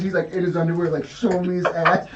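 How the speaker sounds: ordinary delivery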